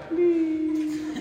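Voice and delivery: put-on a quiet small-girl beg; soft pleading child. Girl holding out bracelet, high soft plea.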